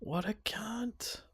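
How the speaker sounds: slow, breathless whispered curse; shocked whisper. Real, not acted. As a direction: whisper it in stunned disbelief. Reacting to the betrayal story.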